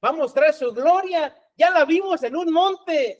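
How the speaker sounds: high, animated voice of eager followers; voicing the disciples. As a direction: act out the disciples wondering aloud. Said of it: "Y ellos decían".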